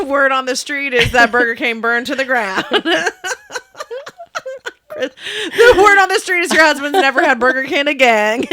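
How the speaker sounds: high, loud laughing delivery; cracking up. Real, not acted. Cohost teasing about husband and Burger King, laughing. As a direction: barely get it out through laughter.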